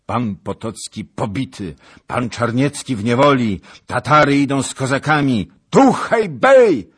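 rising to a loud, high cry; alarmed report. A narrator's reading. Rider reports the army's defeat, mounting alarm. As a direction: pile up disasters, climbing to a shout.

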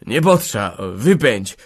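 slow, insistent refusal; stubborn insistence. A narrator's reading. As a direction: refuse doggedly, word by word. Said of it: "powtarzał uporczywie".

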